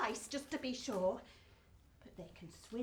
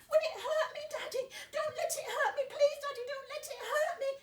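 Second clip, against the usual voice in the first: put-on much higher, pleading frightened child; scared little girl; 11 semitones higher than usual. Little Betsy pleading with her daddy, high and scared.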